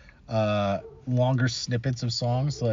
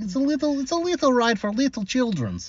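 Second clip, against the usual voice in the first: put-on childish voice; 10 semitones higher than usual. Joking kiddie ride description.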